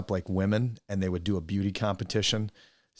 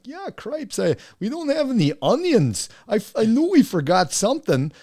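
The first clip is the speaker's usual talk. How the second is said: very high squeaky put-on voice, louder; imitating Todd; 7 dB louder than usual. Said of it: "so Todd's like".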